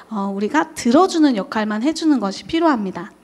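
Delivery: normal talking voice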